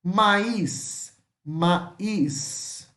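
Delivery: "Maíz" in two syllables, ma-EES; careful Spanish "Maíz", stressed on the í; slow pronunciation model. Shown as a demonstration. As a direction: draw out "Maíz", Z as S. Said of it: "até de pegar a pronúncia".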